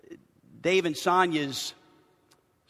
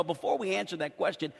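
ordinary talk, nothing marked

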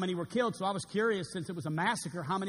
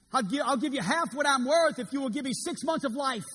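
louder, higher begging; desperate plea; 8 semitones higher, 7 dB louder than usual. Acted. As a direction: bargain in panic for life. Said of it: "He said to his doctor".

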